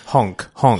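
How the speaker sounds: goofy honking goose noise. Retold or imitated. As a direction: honk like a silly goose. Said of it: "Quoth the goose".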